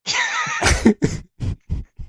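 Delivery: shrill squealing laugh; high-pitched laugh. Real, not acted. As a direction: squeak out a long giggle. Still laughing at the story.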